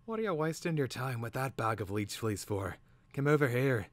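high, raspy creature voice; rasping, insistent. A narrator's reading. Hidden bird summoning Twig from the cub, raspingly insistent. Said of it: "came a rasping yet insistent whisper".

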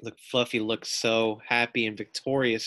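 usual speaking voice

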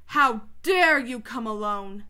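slow, loud, imperious fury; drawn-out, forceful rebuke; terrible witch voice. A narrator's reading. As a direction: thunder it slowly as an outraged queen. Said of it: "said the witch in a terrible voice".

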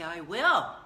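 WILL hit hard and loud; loud, decisive "will"; firm resolve. Shown as a demonstration. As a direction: declare "I will" with a punched WILL. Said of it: "But if you say".

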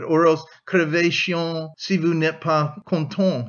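ordinary delivery, nothing marked

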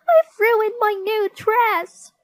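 very high, loud, slow shriek; high-pitched wailing child; Susan's shriek. A narrator's reading. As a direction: shriek it out in dismay, drawn out. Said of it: "shrieked Susan".

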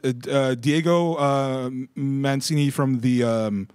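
loud, halting reporter with stumbles; press reporter. Pretend. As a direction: stammer through your name and outlet, then ask loudly. Plays a stammering reporter at conference.

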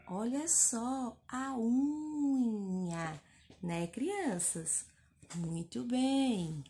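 put-on slow, drawn-out words to kids; slow praise. Shown as a demonstration. Teacher gently praising children's reading. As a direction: linger warmly on each word.

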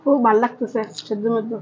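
talking between bites; words muffled by food; mouth full, chewing. Real, not acted. Seen 1: Left woman talks with moving full mouth during eating session.

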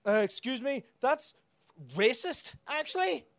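high, affected offended voice; mock-offended heckler voice; prissy complainer. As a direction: mimic a pearl-clutching critic. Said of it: "somebody said to me".

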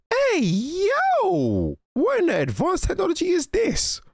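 high, stretched call of disbelief; astonished outcry. Real, not acted. Viewer stunned by the advanced technology, astonished. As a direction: pitch it way up in amazement.

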